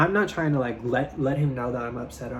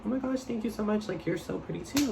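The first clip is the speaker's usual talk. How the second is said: put-on higher, breathless girly gush; higher sweet girly voice; girly gushing reply; 7 semitones higher, 6 dB quieter than usual. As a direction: play the flattered girlfriend. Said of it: "she's like".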